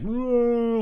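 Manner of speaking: high, far-off amazed gasp; awed whoa. Pretend. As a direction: gawk like a kid at a monster movie. Imagined monster movie onlooker gasping.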